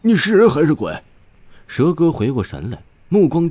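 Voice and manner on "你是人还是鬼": high, loud, stammering shock; as if facing a ghost; stunned disbelief. Acted. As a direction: blurt it out, aghast and stammering. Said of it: "他前所未有的难以置信".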